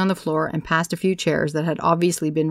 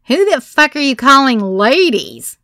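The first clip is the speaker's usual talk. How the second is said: higher and louder heckle; shouted retort; 8 semitones higher, 9 dB louder than usual. A narrator's reading. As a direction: shout it back mockingly. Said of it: "another one shouted".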